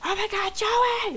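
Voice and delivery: high, loud imitation of a screaming roommate; acted scream. Roommate calling Joey from the toilet at three AM, screaming. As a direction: scream it out like a mock emergency. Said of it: "screaming in the toilet".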